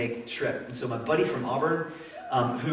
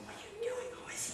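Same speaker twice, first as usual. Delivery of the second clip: much higher, hushed alarmed whisper; 16 dB quieter, faster than usual. Retold or imitated. Everybody watching pastor sit by stranger, alarmed whisper. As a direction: whisper your alarm sharply. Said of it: "and everybody's like".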